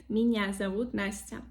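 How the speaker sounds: меня as one smooth "minya"; soft sound, no Y. Shown as a demonstration. Teacher correcting again, soft N in меня. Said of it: "Нет".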